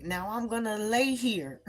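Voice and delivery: restrained retold yell. Telling God she won't move, restrained retold yell. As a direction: imitate yelling, holding the volume back. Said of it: "I'm just describing because I was yelling".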